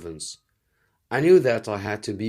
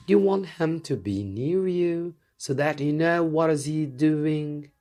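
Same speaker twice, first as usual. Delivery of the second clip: lightly raised, gentle princess voice; a hint of a princess addressing the king; 7 semitones higher than usual. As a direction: speak as the young princess, politely.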